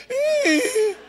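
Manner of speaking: high sob-laugh; crying laugh. Retold or imitated. Woman crying and laughing at once, high sob. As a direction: let a laugh break into a sob.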